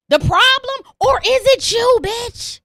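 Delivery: accusing. Real, not acted. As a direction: hammer the accusation home. Rhetorical accusation to listener.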